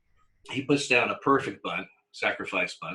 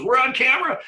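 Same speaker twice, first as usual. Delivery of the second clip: high, loud mock aside; manager's warning; 14 semitones higher, 7 dB louder than usual. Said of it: "he goes".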